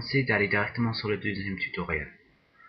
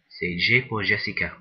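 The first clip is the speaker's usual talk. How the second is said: "G" spelled out louder, with "Jessica" as its key word; emphatic clarification; 8 dB louder than usual. Real, not acted. Tutor clarifying the shortcut letter because of his accent, emphatic. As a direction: say "G pour Jessica" loudly so the letter is unmistakable.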